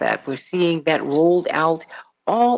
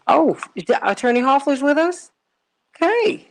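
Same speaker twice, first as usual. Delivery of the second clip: surprised; 10 semitones higher, 4 dB louder than usual. Real, not acted. Host surprised the attorney is on the line, high and startled. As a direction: pitch jumps high in real surprise.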